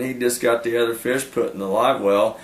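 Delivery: ordinary talk, nothing marked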